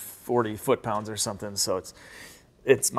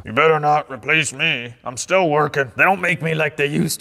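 lightly voiced grumpy old man; a hint of a cranky old-timer; crusty old man voice; 3 semitones higher, 5 dB louder than usual. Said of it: "more like a crusty old man voice".

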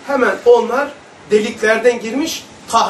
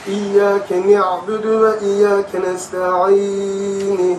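slow, drawn-out chant of the Fatiha; echoing Quran recitation; much slower than usual. Shown as a demonstration. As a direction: recite slowly, letting each phrase ring out. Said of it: "Benim ilah sureyi böyle okudum".

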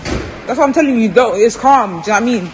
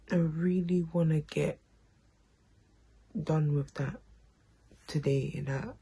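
whispering; 3 semitones lower, 3 dB quieter than usual. Real, not acted. Quiet morning.

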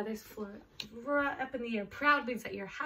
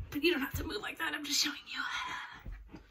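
whisper; 3 semitones higher than usual. Real, not acted. Whispered aside to kids.

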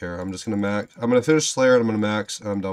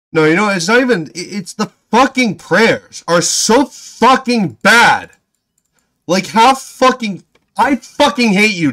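furious rant; 3 semitones higher than usual. Real, not acted. Player raging at Jagex over a failed boss, furious shouting. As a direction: vent everything at the developers.

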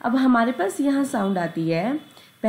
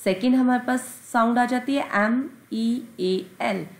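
usual speaking voice

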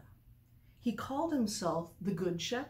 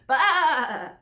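loud, high lamb bleat; distant lamb bleat; 7 semitones higher, 14 dB louder than usual. Acted. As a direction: call out like a lost lamb. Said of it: "he heard in the distance".

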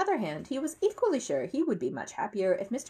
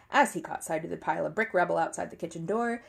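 plain reading voice

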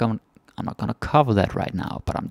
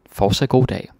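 louder, livelier "Fortsat god dag"; bright shop greeting; 4 semitones higher, 6 dB louder than usual. Shown as a demonstration. Shop cashier greeting a customer at the till, louder.

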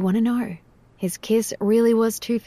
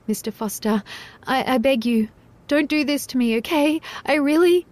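higher pleading, close to tears; trembling plea; 5 semitones higher than usual. Acted. Jade begging Mister Foster to stop, trembling. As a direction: beg him, trembling. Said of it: "Jade almost trembled".

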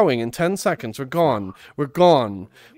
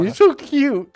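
cooing; 9 semitones higher, 7 dB louder than usual. Real, not acted. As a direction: coo over a cute friend. Cooing at a friend.

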